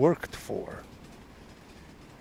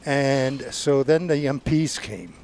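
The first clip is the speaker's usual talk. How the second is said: usual speaking voice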